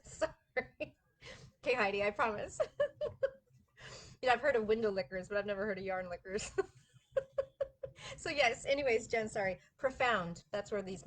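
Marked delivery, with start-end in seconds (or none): 0.0-6.6 giggling through words; laughing while talking. Real, not acted. Laughing at comment.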